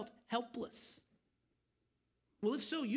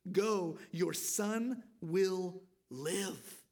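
very slow, gentle promise, lower; reassuring; 3 semitones lower, much slower than usual. Retold or imitated. Jesus reassures the desperate father, slow gentle promise. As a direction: let each word settle like comfort. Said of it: "those are encouraging words".

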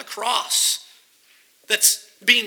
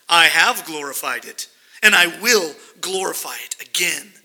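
lower, weighty divine voice; God answering his Son in a lower, solemn voice; voice from heaven; 6 semitones lower than usual. Acted.

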